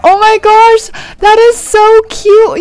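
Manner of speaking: gasp, then high, loud, drawn-out squealing; excited squeal. Real, not acted. Player discovering the Hello Kitty collection, thrilled squeal. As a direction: squeal it slowly with real delight.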